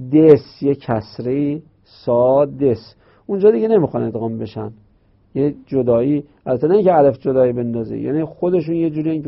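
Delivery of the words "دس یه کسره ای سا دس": سا then دس said slowly and apart; split sadis. Shown as a demonstration. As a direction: break it into سا and دس, slow. (سا دس آهسته و جدا)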